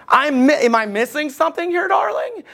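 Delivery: baffled husband, darling rising up high; a farmer calling his wife, puzzled, pitch climbing. Retold or imitated. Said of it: "he's like".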